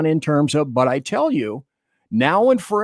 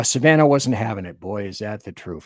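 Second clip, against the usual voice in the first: usual speaking voice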